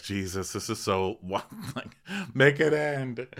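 mild exasperated sigh. Retold or imitated. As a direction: drone it like you're fed up. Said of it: "Ashley just sighs and rolls her eyes".

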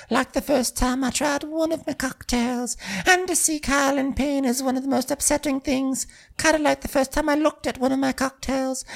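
hammed-up high voice of wounded drama; Natasha. Natasha while cleaning, lamenting her team's loss, high mock-distressed voice. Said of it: "and Natasha's like".

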